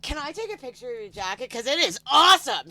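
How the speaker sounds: high, building to a loud gush; enthusiastic admirer. Retold or imitated. Businesswoman asking to photograph the jacket, loud enthusiasm. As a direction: imitate her gushing, punching "awesome".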